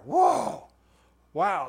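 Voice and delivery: high, loud delighted whoa; surprised whoa. Retold or imitated. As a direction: let the joy burst out as a loud gasp of wonder. Joy growing even more.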